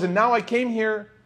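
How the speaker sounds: high, exasperated complaint from the frustrated traveller; frustrated visitor. Retold or imitated. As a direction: pitch it up and vent his frustration. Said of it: "And he goes".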